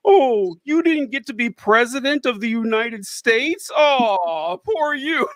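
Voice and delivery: sarcastic mock sympathy, rising high on poor you; sneering sarcastic sympathy; mock pity. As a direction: pour on fake pity for the loser. Mocking Hillary's complaints.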